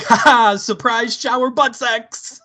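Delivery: high-pitched gleeful mock ambush; spring a silly surprise; hammed-up high "ta-da" delivery; playful surprise announcement.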